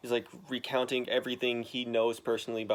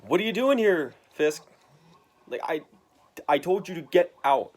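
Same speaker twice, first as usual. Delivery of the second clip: loud, raised confrontation; angry threat; 5 semitones higher, 7 dB louder than usual. Retold or imitated. Daredevil confronting Kingpin, loud and angry. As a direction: bark at Fisk. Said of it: "He's like".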